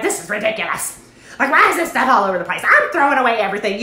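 mock exasperated mom rant; mom fussing. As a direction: fuss like a fed-up mom, voice climbing. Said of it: "like, fussing the whole time".

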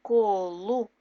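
slow syllable-by-syllable reading; syllables drawn out. Shown as a demonstration. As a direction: sound out each syllable for kids. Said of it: "syllable reading demo".

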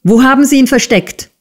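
high, fast, accusing question; urgent irate interrogation; angry demand. A narrator's reading. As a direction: demand it hotly, voice jumping up. Schoolmaster angrily accuses the assistant.

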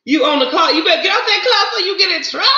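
playful laughing warning, rushed; laughing tease. Real, not acted. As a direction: tease a friend through laughter. Teasing viewer at work, laughing.

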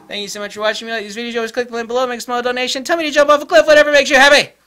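high, racing patter building to a shout; rapid-fire plea. Pretend. Vlogger asking viewers for donations, hammed-up rapid shouting.

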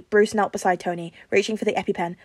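plain reading voice

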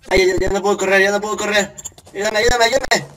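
high loud desperate pleading; panicked cry for help. Real, not acted. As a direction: cry out while being caught. Being chased, can't run.

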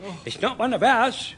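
normal talking voice

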